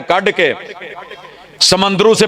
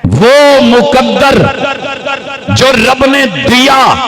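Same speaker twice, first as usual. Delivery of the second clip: raised, slow, weighty sermon climax; slow emphatic preaching; 8 semitones higher, slower than usual. Acted. Preacher praises those who keep beards, slow and raised. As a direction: lean on each phrase slowly.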